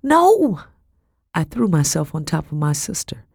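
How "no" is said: high, drawn-out cry; anguished protesting yell; desperate shout. A narrator's reading. Narrator throws herself over sister.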